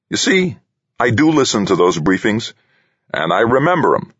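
a hint of a brash leader; president voice. President character voice.

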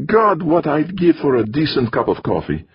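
put-on high, complaining foreign-accented grumble; grousing Hungarian. A narrator's reading. Torok grousing about the coffee, accented. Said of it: "the Hungarian groused".

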